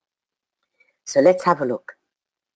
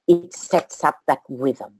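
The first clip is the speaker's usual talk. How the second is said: usual speaking voice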